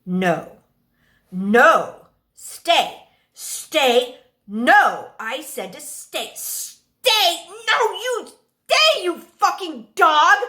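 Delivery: put-on boy losing his temper, shouting stay; angry yelling at the dog. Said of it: "the anger and frustration in his voice".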